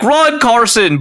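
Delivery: a German fan's shout; a lightly voiced German fan shouting; German accent. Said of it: "but in, like, a German accent".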